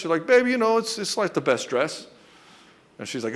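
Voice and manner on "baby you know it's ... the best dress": mock high-pitched hedging, dropping at the end; placating husband. Husband dodging wife's question about the dress, placating. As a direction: imitate a husband stalling, voice jumping up high. Acted flustered husband answering wife.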